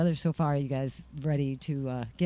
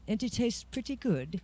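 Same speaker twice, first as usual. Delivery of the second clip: mock British accent; 5 semitones higher, 3 dB quieter than usual. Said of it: "That's, that's not a bad accent".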